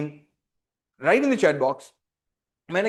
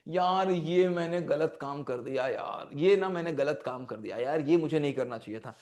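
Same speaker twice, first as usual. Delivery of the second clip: regretful self-talk; 6 semitones lower, 6 dB quieter than usual. Acted. Person admitting to themselves a wrong thing done, regretful self-talk. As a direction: own up to yourself quietly, voice dropped. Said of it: "अपने आप से ही".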